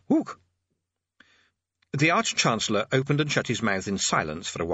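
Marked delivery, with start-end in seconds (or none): 0.1-0.4 short, very high ape hoot; orangutan hoot. Acted. Orangutan librarian interrupts the speech, hooting.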